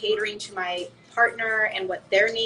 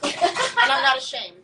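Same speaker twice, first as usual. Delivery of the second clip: laughing; 4 semitones higher, 4 dB louder, slower than usual. Real, not acted. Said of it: "I just plugged my show".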